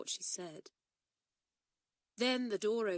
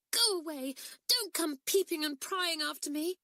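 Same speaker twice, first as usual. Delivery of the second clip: louder, higher, pushing them away; tearful outburst; 10 semitones higher, 5 dB louder than usual. A narrator's reading. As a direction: snap it out through tears, louder. Crying girl drives friends off.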